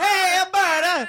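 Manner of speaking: mock Italian accent. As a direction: drag out the hammed-up Italian accent. Echoes the mock accented greeting.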